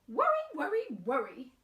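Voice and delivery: high, loud fussy granny; sing-song it like a fussing granny; grandmother voice. Acted. Grandmother scolding Wemberly, high and loud. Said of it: "said her grandmother".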